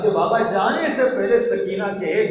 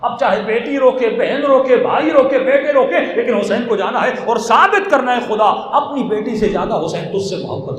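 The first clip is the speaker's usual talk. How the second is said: rising, louder and higher, pleading with the crowd; impassioned preaching; 5 semitones higher, 6 dB louder than usual. Acted. Preacher urging the gathering, building to a loud impassioned plea. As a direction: preach it to the mourners, building in force.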